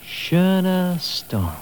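extremely slow, lingering on every sound; savoring the name. Shown as a demonstration. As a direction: let it sing. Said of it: "does it sing for you?".

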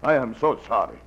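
mock-sorry Russian accent. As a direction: apologize in a hammed-up Russian accent. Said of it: "like the accent, the beard".

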